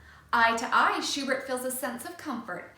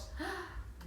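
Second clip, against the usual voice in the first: scared gasp; 8 semitones higher, 12 dB quieter than usual. A narrator's reading. Scared boy.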